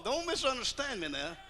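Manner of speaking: usual speaking voice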